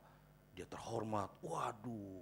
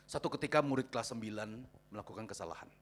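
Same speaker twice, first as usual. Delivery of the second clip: ordinary talk, nothing marked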